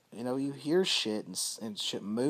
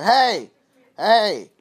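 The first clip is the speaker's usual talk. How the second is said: much higher, much louder shouted call; 9 semitones higher, 16 dB louder, much slower than usual. Retold or imitated. Someone calling Josh from another room, loud shouted call. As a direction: yell the name across the house. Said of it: "And they kinda talk loud".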